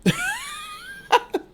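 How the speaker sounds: a high burst of laughter; real laugh; high squealing laugh. Real, not acted. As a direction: cackle high.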